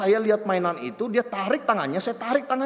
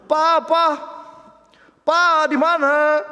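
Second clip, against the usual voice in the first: put-on high, loud child calling for her dad; mock child searching aloud for papa, higher and louder; 9 semitones higher, 8 dB louder, much slower than usual. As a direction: call out for papa like a lost little girl. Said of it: "dia mulai berkata".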